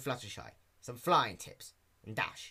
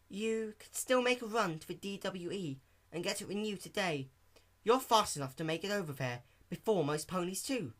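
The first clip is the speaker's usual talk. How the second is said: Fluttershy voice; 7 semitones higher than usual. Acted. Fluttershy suggests.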